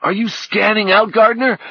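half-panicked, can't believe it; alarmed disbelief. A narrator's reading. Fredericks yelling at Orlando as they sink, incredulous.

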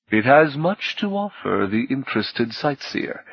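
measured, ceremonial speech to guests; stately welcoming speech; gracious host's address. Acted. Voices the host's formal speech.

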